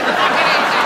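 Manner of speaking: ordinary delivery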